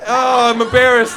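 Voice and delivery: ordinary delivery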